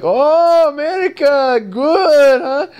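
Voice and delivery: high, loud teasing Russian voice; mock Russian accent. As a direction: taunt like a Russian player, pitched high. Imitating Russian players.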